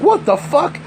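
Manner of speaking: high, stunned what the fuck from the mom; mock the mom's disbelief; retelling how his sister reacted, a high startled cry; shocked mom. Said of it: "and she's like".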